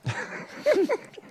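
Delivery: a burst of high laughter at her own joke; laughing at her own mock jibe about foreigners. Real, not acted. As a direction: break into a short, squealing laugh.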